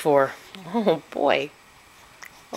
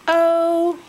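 very high, louder cooing oh; high baby oh; 13 semitones higher, 8 dB louder than usual. Pretend. Mother cooing oh to her baby, very high. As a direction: coo it for the baby.

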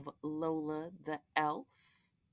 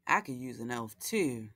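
lively picture-book read-aloud with character voices; reading to children; slower than usual. A narrator's reading. Host reading Lola the Elf to young viewers, storytelling voice. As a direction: read it like a bedtime story for little ones.

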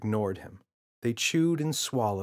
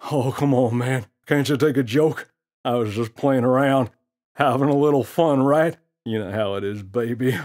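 louder, swaggering wise-guy gorilla; a cocky gorilla teasing the kid; smirking gorilla; 3 semitones higher, 9 dB louder than usual. Acted. Gorilla voiced in story.

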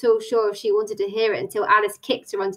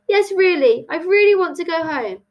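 high, insistent plea; 8 semitones higher, 4 dB louder than usual. Acted. Tilly wants to go home, insistent. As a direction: press it firmly, pitched up.

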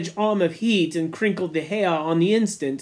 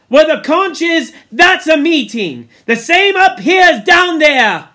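high, loud rallying speech; rally the boys firmly; rousing; 8 semitones higher, 8 dB louder than usual. Acted. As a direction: Ralph laying down rules. Ralph addressing the assembly.

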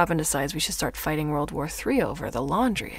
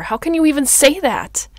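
very loud, high, indignant cry; hurt boiling over into a shout; outraged outburst; 7 semitones higher, 11 dB louder than usual. Acted. Outburst after being accused.

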